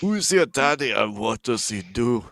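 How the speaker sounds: loud Austrian action-hero demand; hammed-up Austrian cop demand; Arnold movie quote. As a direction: bellow the question like a tough cop. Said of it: "Arnold references now?".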